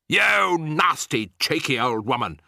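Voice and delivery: put-on outraged husband scolding the old woman; angry shout. A narrator's reading. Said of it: "shouted Mister Jenkins".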